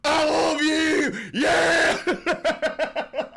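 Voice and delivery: screaming high and loud like a crazed fan; mock fan scream. Streamer mocks a Trump fan screaming adoration. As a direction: shriek your love like a MAGA fan.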